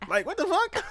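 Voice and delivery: high, stunned what the fuck; shocked disbelief. Real, not acted. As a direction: react like you can't believe it. Friend reacts to mom's calm.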